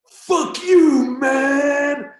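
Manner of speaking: loud, high-pitched furious scream; imitating a scary, screaming outburst; full-lung yelling. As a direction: yell it at the top of your lungs. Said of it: "Starts yelling at top of his fucking lungs".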